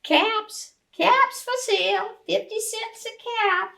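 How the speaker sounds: high calling out of wares; peddler's sales call. A narrator's reading. Peddler walks back to town calling out his caps.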